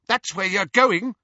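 high, loud gloating; spiteful relish. A narrator's reading. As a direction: taunt with obvious relish. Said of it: "said Petunia with relish".

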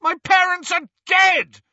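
loud, high, furious bellow. Acted. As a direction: bellow it in rage. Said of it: "Harry bellowed".

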